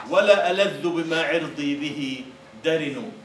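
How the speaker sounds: slow proud declaiming; poem recital. Acted. Teacher reciting Mutanabbi to the class, slow and proud. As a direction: recite it with dignity.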